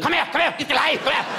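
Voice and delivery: high, coaxing come-here call; creepy luring voice. Pretend. Comic mocking what kids imagine he says, coaxing. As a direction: lure the kids in, hammed-up.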